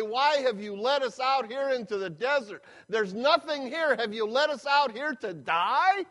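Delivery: complaining. Retold or imitated.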